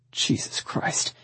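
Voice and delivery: breathy, slow mutter under the breath; muttered curse. A narrator's reading. Man at the window to soaked woman, muttering. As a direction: mutter it softly, drawn out. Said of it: "he muttered".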